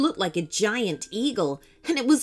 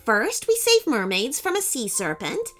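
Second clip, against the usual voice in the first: put-on excited young girl; 7 semitones higher than usual. A narrator's reading. Child recounting adventures excitedly.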